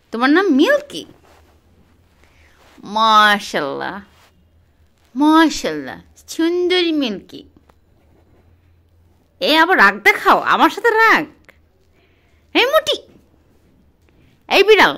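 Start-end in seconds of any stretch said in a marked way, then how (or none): 3.0-7.4 slow, drawn-out, louder cooing of praise; slow, loud, admiring coo; drawn-out admiration. Real, not acted. Admiring the cat. 9.4-14.9 louder, teasing telling-off of a grumpy cat; playful loud scolding; mock scolding.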